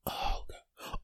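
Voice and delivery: very soft, breath-only groan; despairing whisper. Acted. Man still locked out, despairing whisper. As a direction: whisper it in defeat.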